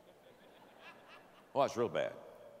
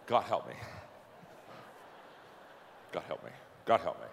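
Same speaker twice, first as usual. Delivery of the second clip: low, faltering plea of a lost preacher; a hint of a panicked young preacher begging; imitating a nervous preacher; 10 semitones lower, 7 dB quieter, faster than usual. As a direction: mutter it like you've forgotten everything.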